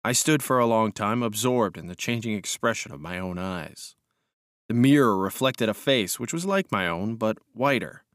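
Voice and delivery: ordinary delivery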